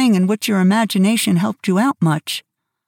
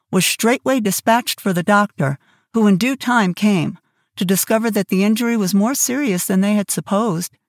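ordinary delivery

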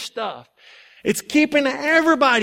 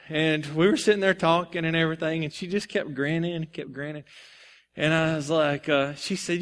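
ordinary talk, nothing marked

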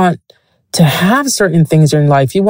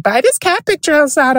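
high-pitched nagging voice for her late friend; friend's voice; 11 semitones higher, faster than usual. Retold or imitated. Voicing late friend Janine urging her to buy, high wheedling. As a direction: push it up high and nag, wheedling. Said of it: "the bitch was like".

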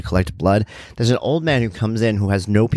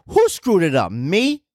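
higher, incredulous no-nonsense woman; indignant lab worker; 10 semitones higher, slower than usual. Retold or imitated. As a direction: fire it back at the old man, pitched up. Lab worker pushes back.

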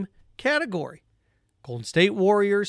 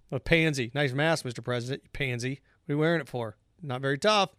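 mock tough guy; 5 semitones lower, 6 dB quieter than usual. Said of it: "See how ridiculous that sounds?".

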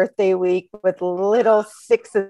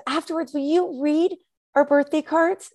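put-on high little-kid question; child's voice; 11 semitones higher than usual. First grader asking the teacher, in a high child voice. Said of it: "one of the kids raises their hand and says".

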